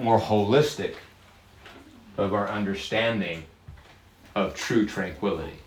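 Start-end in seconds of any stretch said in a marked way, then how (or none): none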